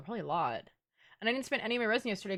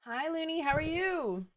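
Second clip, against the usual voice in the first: high, drawn-out hello; high-pitched greeting; 8 semitones higher than usual. Real, not acted. Streamer greets a viewer joining chat, high-pitched greeting.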